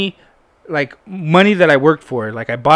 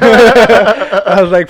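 laughing; 5 semitones higher, 10 dB louder, much slower than usual. Real, not acted. Laughs at his own story.

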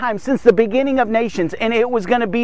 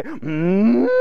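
cow moo; 7 semitones higher than usual. Pretend. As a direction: moo like a cow, then crack up. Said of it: "that was my cow impression".

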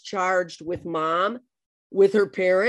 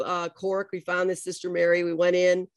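ordinary talk, nothing marked

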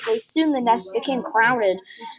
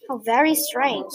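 usual speaking voice

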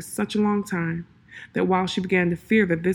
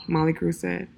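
ordinary delivery, nothing marked

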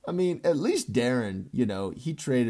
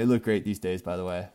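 normal talking voice

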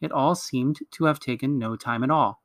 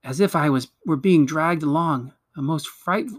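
ordinary delivery, nothing marked

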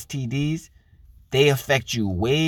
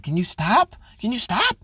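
higher, whiny complaint rising at the end; mock annoyed wife; 9 semitones higher than usual. Mimicking wife telling husband to stop, whiny. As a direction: push him away with a nagging whine. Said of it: "y'all be like".

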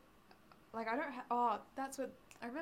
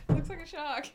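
laughing; 8 dB louder than usual. Real, not acted. Laughing about her shark nose.

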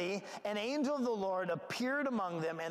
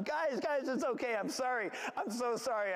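mock shout; 6 semitones higher than usual. Angel calling to terrified shepherds, mock shout. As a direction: call out to calm them, hammed up. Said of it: "he shouted to them".

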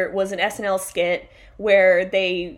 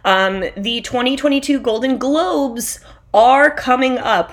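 ordinary talk, nothing marked

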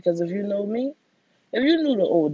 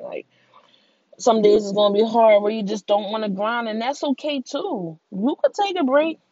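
normal talking voice

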